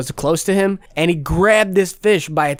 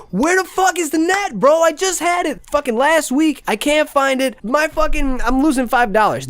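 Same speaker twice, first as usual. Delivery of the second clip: much higher, strained mock panic; frantic angry rant; 11 semitones higher than usual. Searching for the lost net while his fish dies, frantic angry yell. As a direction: yell it like you're tearing the house apart. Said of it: "I was so mad while I was looking for it".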